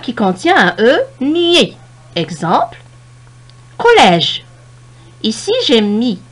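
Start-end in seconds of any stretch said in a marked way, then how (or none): 3.8-4.4 "collège" with a loud, open è. Shown as a demonstration. Teacher modelling the grave accent, "collège" with open è. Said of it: "E ouvert".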